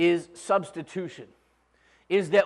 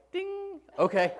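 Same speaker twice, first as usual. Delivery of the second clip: goofy Abraham; 12 semitones higher, 4 dB quieter, slower than usual. Retold or imitated. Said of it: "Abraham's like".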